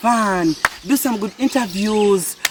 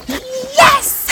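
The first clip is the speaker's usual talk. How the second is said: joyful shout. Real, not acted. Cheering on the island.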